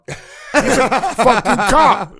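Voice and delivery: slow, loud disbelieving reveal; incredulous reveal. Real, not acted. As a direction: land the punchline in disbelief. Punchline reveal of the cop.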